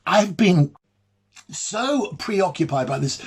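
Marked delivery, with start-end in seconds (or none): none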